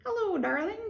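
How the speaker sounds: high parrot mimicry. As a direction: echo it back like a parrot. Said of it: "And the parrot says".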